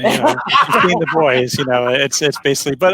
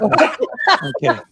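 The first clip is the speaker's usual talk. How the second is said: high, slow sing-song; drawn out, teasing and high; mock sing-song; 14 semitones higher, much slower than usual. Teasing the departed guest, high sing-song. Joking after guest leaves.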